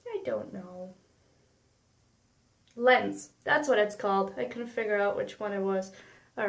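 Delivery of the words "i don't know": soft trailing repeat; much softer, under her breath; quiet. Real, not acted. Repeats it softly after goofy take.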